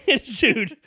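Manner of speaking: ordinary talk, nothing marked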